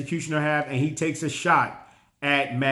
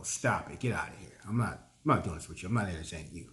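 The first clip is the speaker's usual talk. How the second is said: low, muttered brush-off; dismissive aside; 8 semitones lower, 10 dB quieter, faster than usual. Pretend. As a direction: wave them off under your breath. Brushing off an imagined rival.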